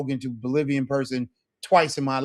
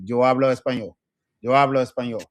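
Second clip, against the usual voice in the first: faster drill; 5 dB louder, faster than usual. Shown as a demonstration. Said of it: "increase the speed on the metronome".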